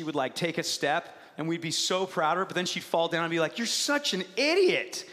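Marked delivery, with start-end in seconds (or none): 3.6-5.1 higher, drawn-out scolding; mock yelling. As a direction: pretend to berate the toddler. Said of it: "we'd just yell at her".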